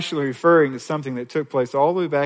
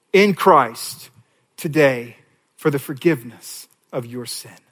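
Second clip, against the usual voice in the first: usual speaking voice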